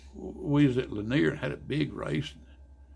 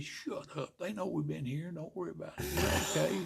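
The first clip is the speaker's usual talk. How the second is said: much softer, a hush to a teammate; 4 semitones higher, 7 dB quieter than usual. Retold or imitated. Hushing a teammate near the inspectors, soft and secretive. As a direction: keep it down so nobody hears.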